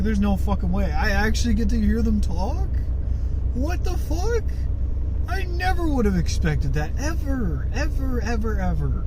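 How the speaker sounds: retold astonishment, pitch leaping high; happy disbelief. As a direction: relive the thrilled shock, then come down on each "ever". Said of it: "I was so happy".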